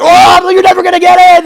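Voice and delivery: very high, loud reenacted scream; defiant scream. Man resisting police at a car stop, defiant scream. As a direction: shriek defiance at the cops, hammed up.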